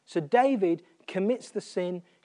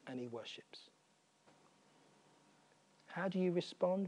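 softer and softer, reverent hush; hushed whisper; 3 semitones lower, 10 dB quieter than usual. Real, not acted. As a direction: whisper it, almost breathless with awe. Preacher drops to hushed worship.

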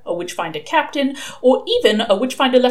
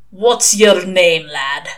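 put-on gruff old man; 5 dB louder, much slower than usual. Shadwell questioning a young caller, gruff and slow. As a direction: lean slowly on the question, louder.

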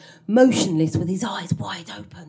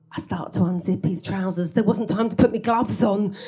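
ordinary delivery

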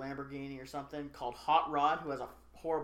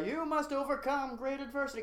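lofty, higher mock-solemn pronouncement; grand mentor speech; 9 semitones higher than usual. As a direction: intone it over swelling organ music. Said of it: "Could you stop playing that organ?".

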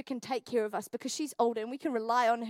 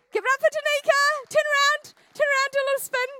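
high, loud cheering announcement; hyping up the crowd; 10 semitones higher, 7 dB louder than usual. Real, not acted. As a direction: whoop them up for applause. Hypes up a person for applause.

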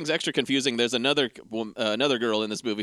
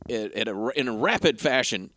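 usual speaking voice

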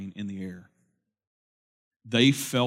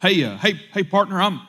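put-on higher, louder, fast nervous old man at the window; nervous stranger; 9 semitones higher, 7 dB louder than usual. Sixty-two-year-old man at the car window, nervous and fast. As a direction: rush it, anxious not to offend. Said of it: "he said".

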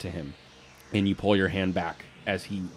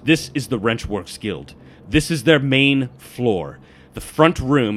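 emphatic briefing, slowing and getting louder; mildly aggressive; 4 semitones higher, 6 dB louder than usual. Acted. Explains the guild while carving the map, mildly aggressive. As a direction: lay it out with some force. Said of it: "carving into this table really aggressively".

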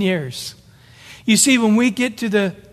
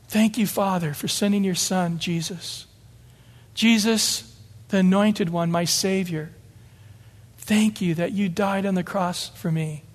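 heartfelt prayer; 4 dB quieter than usual. Real, not acted. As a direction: pray quietly and sincerely, addressing the Father. Sudden prayer within the sermon.

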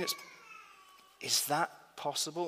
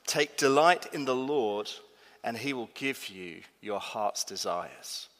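a solemn, unhurried verse reading; slow scripture reading; 4 semitones lower than usual. Shown as a demonstration. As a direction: read it slowly and solemnly. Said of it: "It says this".

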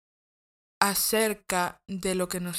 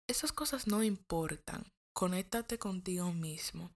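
soft unvoiced whisper; whispered advice; 2 semitones lower, 7 dB quieter than usual. Real, not acted. She whispers advice to her listener to turn inward. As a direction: whisper it close, like a confidence.

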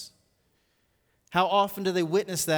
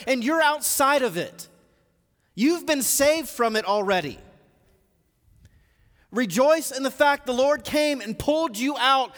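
raised, forceful pulpit voice; impassioned preaching; 6 semitones higher, 5 dB louder than usual. Real, not acted. Preacher urging the congregation to rejoice, impassioned and emphatic. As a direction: preach it with mounting urgency, punching key words.

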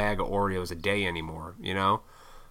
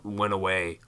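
normal talking voice

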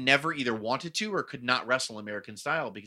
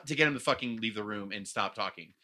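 usual speaking voice

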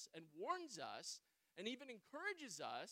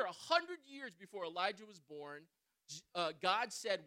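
usual speaking voice

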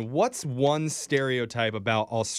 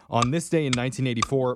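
ordinary talk

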